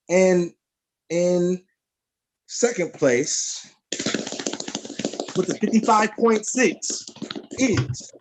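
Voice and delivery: slow, high, drawn-out announcement; hype announcer. Real, not acted. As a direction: build suspense to the name. Host announces second-place winner.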